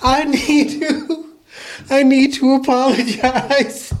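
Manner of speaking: high, slow, squeaky-with-laughter apology; real giggling fit, words squeezed out high; helpless laughter. Real, not acted. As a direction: try to apologize while cracking up. Helpless laughter after slamming door.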